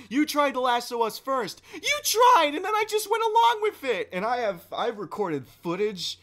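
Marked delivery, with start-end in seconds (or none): 0.1-1.6 mock accusing yell, raised pitch; retold friends' shouted accusation. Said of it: "And then they're yelling". 1.7-4.1 very high, loud, rapid protest; reenacted heated comeback; indignant retort. Retold counter-accusation in argument.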